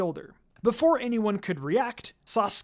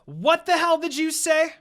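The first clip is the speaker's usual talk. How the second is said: much higher and louder outraged shout; furious yell; 9 semitones higher, 7 dB louder than usual. Acted. Karin screams at Minako over the four-eyes insult, furious. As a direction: explode at the insult.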